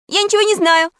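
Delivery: very high, loud denial; exclaiming. A narrator's reading. Fake Pashka, accused by Alice, exclaims a high denial. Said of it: "воскликнул Пашка".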